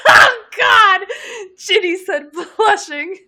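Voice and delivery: high, loud laughing shriek. Real, not acted.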